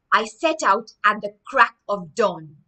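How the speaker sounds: lively storybook narration; story reading. Acted. Teacher reads the story aloud to children, lively narration. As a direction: read it out to the class with energy.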